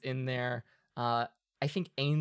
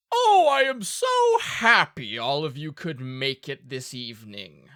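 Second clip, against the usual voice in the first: loud, booming host's welcome; jolly duke voice; 9 dB louder than usual. Acted. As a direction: boom it like a merry host.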